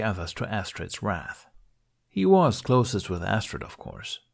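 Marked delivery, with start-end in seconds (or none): none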